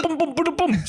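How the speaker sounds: high playful boom boom gunfire; gun sound effect. Retold or imitated. Imitating a machine gun firing into mouths. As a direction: bang it out like a toy gun.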